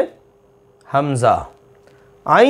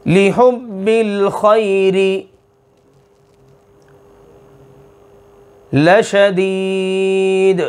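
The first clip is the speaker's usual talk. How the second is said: slow, drawn-out tajweed chant; Quran recitation; much slower than usual. Acted. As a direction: recite each phrase long and measured. Quran recitation opening lesson.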